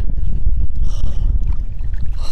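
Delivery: big loud exhale of disbelief; loud overwhelmed sigh. Real, not acted. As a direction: let out a huge stunned breath. Overwhelmed by the find.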